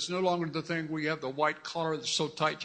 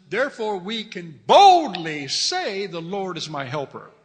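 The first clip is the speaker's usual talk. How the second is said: slow, loud proclaiming voice; preacher booming scripture; bold declaration; 10 dB louder than usual. Acted. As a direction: proclaim it boldly from the pulpit. Reading verse with force.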